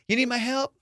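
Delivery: high, eager assistant voice; Alexa voice. Retold or imitated. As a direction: pop up sweetly offering help. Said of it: "she'll be like".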